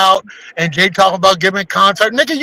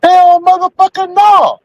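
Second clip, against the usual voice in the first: indignant shout; 8 semitones higher, slower than usual. Real, not acted. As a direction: bark it out in outrage, pitch up. Flat refusal of HOA.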